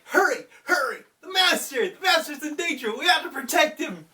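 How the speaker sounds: high, fast, shouted alarm; urgent crow shout. Retold or imitated. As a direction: cry the alarm, racing to save the master. Crows calling, master in danger.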